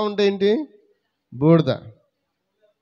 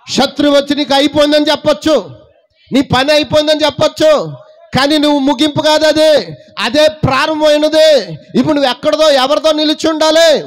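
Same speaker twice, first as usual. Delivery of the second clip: high, loud, ringing preacher's cry; full-force preaching; 8 semitones higher, 7 dB louder than usual. Acted. Preacher lifts his voice loudly to the congregation. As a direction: proclaim it loudly to the church.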